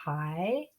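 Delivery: HAI low, dipping then rising; third tone. Shown as a demonstration. Teacher naming the first character, HAI in dipping third tone. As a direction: take HAI softly down and up. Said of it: "with third tone".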